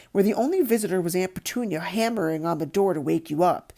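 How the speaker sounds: ordinary delivery, nothing marked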